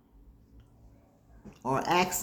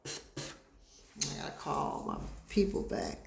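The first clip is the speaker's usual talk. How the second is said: whispered aside; 10 semitones lower, 11 dB quieter, slower than usual. Real, not acted. Reminding herself, under breath.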